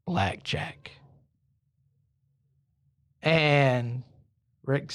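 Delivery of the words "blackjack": low, hushed whisper; soft disembodied voice from above; voice of God. Acted. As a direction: whisper it as a mysterious command. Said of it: "The voice goes".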